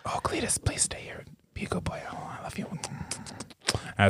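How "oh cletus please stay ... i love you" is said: soft whispered endearments; sweet nothings. Acted. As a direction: whisper lovingly into his ear. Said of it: "whispering sweet nothings in his ear".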